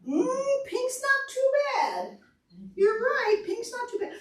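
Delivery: high, light, slow little butterfly voice. Pretend. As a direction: squeak it out like a tiny picky butterfly.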